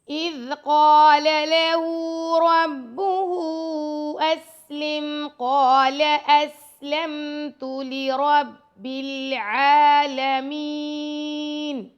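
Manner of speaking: slow, melodic tajweed chant; Quran recitation. Shown as a demonstration. Teacher rereads the whole verse in melodic recitation. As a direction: recite the verse slowly, like a qari.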